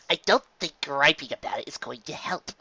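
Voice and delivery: ordinary delivery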